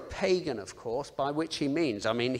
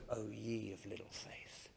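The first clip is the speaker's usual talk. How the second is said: soft, much quieter and kind; gentle, understanding; 5 semitones lower, 15 dB quieter than usual. Shown as a demonstration. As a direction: say it with a gentle shake of the head. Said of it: "you could read it more gently".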